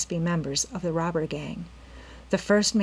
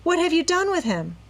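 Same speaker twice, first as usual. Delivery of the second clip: much higher frightened plea; horrified; 11 semitones higher than usual. A narrator's reading. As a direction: demand it in panic. Nancy horrified about caretaker.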